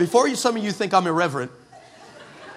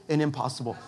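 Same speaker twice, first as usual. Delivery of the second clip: usual speaking voice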